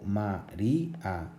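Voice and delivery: "María" slowly split as ma-rí-a; hiatus, syllable by syllable. Shown as a demonstration. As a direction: separate the í and a of "María". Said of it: "se pronuncian en vocales diferentes".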